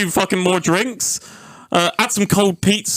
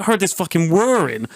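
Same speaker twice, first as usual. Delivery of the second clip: normal talking voice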